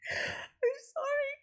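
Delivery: squeaky, slow apology laughed to tears; real tearful laughing sorry; crying laughter. Real, not acted. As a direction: wheeze it out through helpless laughter. Laughing until near tears.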